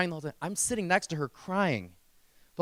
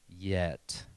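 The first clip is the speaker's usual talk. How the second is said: low, drawn-out dramatic pause word; 8 semitones lower, 4 dB quieter than usual. Shown as a demonstration. Speaker on stage, milking the moment: dramatic low reveal. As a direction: deliver it like a big reveal. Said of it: "I make it really dramatic".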